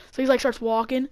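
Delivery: ordinary delivery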